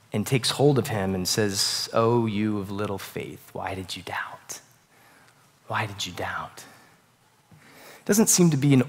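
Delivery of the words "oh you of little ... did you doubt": soft, slow, near whisper; gentle rebuke. Retold or imitated. Jesus softly asking Peter why he doubted, tender near whisper.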